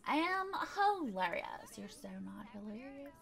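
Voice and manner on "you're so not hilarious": whispered aside. Real, not acted. Self-rebuttal aside.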